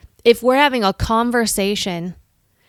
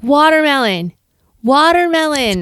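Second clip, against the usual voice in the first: higher, louder call of the safe word; mock safe-word plea; 7 semitones higher, 4 dB louder than usual. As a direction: blurt the safe word to stop him, higher. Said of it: "I'm like".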